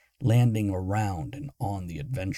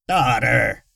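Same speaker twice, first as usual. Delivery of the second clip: screech; 2 semitones higher, 8 dB louder than usual. Acted. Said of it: "the jittery voice screeched".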